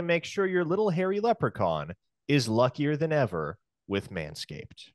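lively scripted ad delivery with swooping pitch; sponsor ad read. Pretend. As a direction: sell it like a playful radio spot. Reads the sponsor ad.

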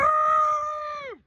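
high shrieking laughter. Real, not acted. Laughing shout after ride.

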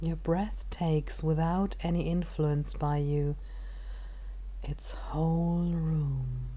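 calm, unhurried meditation guide with long pauses; measured body-scan narration, phrase by phrase; guided meditation. Acted.

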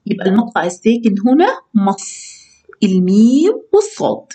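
usual speaking voice

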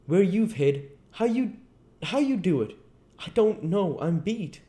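high-pitched, exasperated tramp voice; bewildered tramp. Acted. Tramp Marvel baffled by flying stones, high and exasperated.

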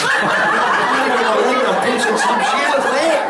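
very high, strained comic wail; hammed-up frantic cry. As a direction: squeal it in mock hysteria. Reenacting comic realisation about the leg.

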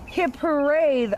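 high, bright cheer. Acted. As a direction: cheer them on. Cheer at story's end.

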